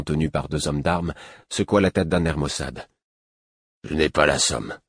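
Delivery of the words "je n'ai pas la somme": slurred lisp. A narrator's reading. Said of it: "élocution chuintante".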